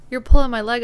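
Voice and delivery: loud, incredulous guy; disbelieving. Retold or imitated. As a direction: call out her bluff loudly. Guy doesn't believe her.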